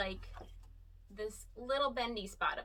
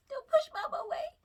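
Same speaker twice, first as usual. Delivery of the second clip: high, louder playful plea; pleading; 5 dB louder than usual. Real, not acted. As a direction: whine it to the pet. Pleading with ferret.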